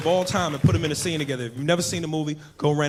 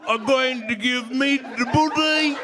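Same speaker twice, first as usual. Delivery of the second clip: high, drawn-out wail; mock high-pitched demand; put-on pleading character; 9 semitones higher, much slower than usual. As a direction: stretch it out high and slow. Comic put-on voice in bit.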